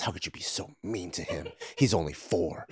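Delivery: very soft, low mock reproach; hurt reproach. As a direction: imitate a wounded scolding under the breath. Dad's tearful reproach, retold.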